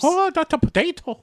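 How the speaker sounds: high cartoon leprechaun accent; hammed-up, high stage Irish. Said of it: "very leprechaun-y".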